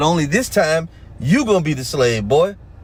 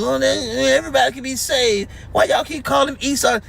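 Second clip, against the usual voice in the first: mocking their pleas; 9 semitones higher than usual. Preacher mocks followers who want to save devils, high whiny mockery. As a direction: sneer at them in a high whiny voice. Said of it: "A lot of you trying to save these fucking devils".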